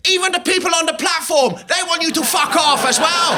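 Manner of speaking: high-pitched mock scream; loud, shrieked caricature; screaming phone woman. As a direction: imitate an irate woman yelling down the phone. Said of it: "this woman's like".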